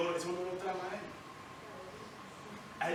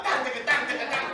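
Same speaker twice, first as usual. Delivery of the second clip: playful, higher "tan, taca, tan" drumbeat sounds; drum imitation; 6 semitones higher, 6 dB louder than usual. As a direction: beat out the drum with your voice, laughing. Imitating drums on cooking pots. (imitando una batería con la voz)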